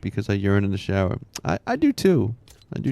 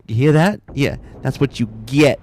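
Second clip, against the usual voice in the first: louder, higher gleeful taunt; mock taunt; 4 semitones higher, 5 dB louder than usual. Retelling the fart-noise punchline, gleeful mock taunt. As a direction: gloat into the phone. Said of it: "and he's like".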